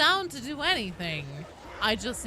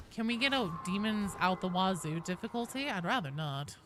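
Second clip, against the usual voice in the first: normal talking voice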